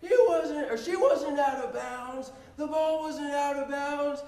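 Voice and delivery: high, whiny protesting crowd; hammed-up indignant crowd; mock protest. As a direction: complain like outraged parents. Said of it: "everyone's like".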